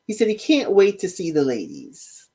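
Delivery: ordinary delivery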